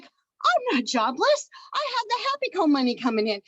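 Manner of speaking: excitedly reliving sudden relief; retold elated realization. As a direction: act out a delighted discovery. Acts out her relieved realization.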